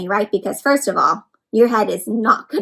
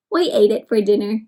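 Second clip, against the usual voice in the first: usual speaking voice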